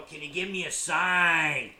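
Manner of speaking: loud, slow, hammed-up demand; mock demanding question. Shown as a demonstration. Mocking a bad question to spirits, loud and slow. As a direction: bellow it like a clumsy ghost hunter.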